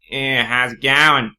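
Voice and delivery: slow, loud cracky Australian drawl; cracky Australian accent. Shown as a demonstration. Australian showing how the accent sounds to them, cracky Australian accent. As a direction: exaggerate a broad Australian accent, drawn out. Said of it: "sort of a cracky sort of".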